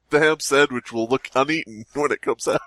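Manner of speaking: ordinary delivery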